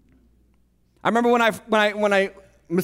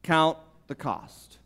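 slow, deliberate; 6 semitones lower, 5 dB quieter, much slower than usual. Shown as a demonstration. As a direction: Count the cost said slow and soft, each word weighed. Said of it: "Can we say those three words together?".

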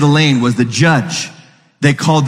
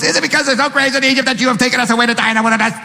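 fast, mocking complaint; whiny complaining Israelites; 8 semitones higher, much faster than usual. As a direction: rattle off the grumbling like a sulky crowd. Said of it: "I don't know if they said it like that, but that's how I hear it".

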